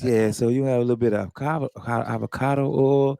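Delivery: low, smooth cooking show host; sexy chef voice. Retold or imitated. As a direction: purr it like a seductive TV chef. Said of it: "he was doing the sexy chef voice".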